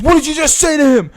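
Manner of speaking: loud, high mock confrontation; angry challenge. Stranger squaring up to the teller, loud angry challenge.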